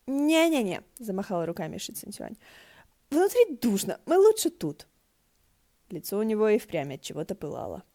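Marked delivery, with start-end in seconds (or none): 0.1-4.9 high, louder hurried no; blushing protest; flustered refusal. Acted. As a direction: wave it off nervously. Shi Qingxuan flustered refusing.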